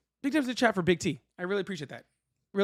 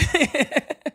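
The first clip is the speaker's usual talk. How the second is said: laughing; 9 semitones higher than usual. Real, not acted. Reacting to the rawr.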